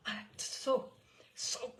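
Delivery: breathless hiss. A narrator's reading. Furious umpire hisses at the narrator, breathless. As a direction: spit it through your teeth. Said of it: "he hissed".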